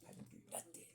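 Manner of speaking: hushed, unvoiced plea of innocence; whispered denial. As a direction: whisper it like a caught kid. Kids denying in whisper.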